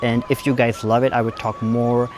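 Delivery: careful, drawn-out American accent. Shown as a demonstration. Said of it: "थोड़ा English American में बोल के देखते हैं". (धीमा, गहरा अमेरिकन लहजा)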